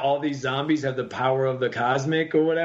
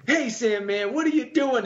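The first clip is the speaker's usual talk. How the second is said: Spider-Man greeting; 10 semitones higher than usual. Retold or imitated. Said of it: "So the Spider-Man's like".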